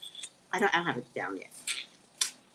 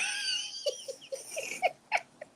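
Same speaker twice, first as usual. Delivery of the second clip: shrieking high laughter near tears; losing it completely, laughing till you cry; howling laugh; 4 dB quieter than usual. Real, not acted. Cracking up about her brother.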